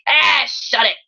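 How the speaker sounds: irritated raspy holler, not full volume; raspy shout. A narrator's reading. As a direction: snap it out in a raspy voice. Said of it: "a raspy voice shouted from the darkness".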